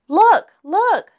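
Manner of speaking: high, loud cub's cry of look; excited shout. A narrator's reading. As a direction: call out excitedly to the family. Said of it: "shouted Sister".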